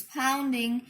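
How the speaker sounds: ordinary delivery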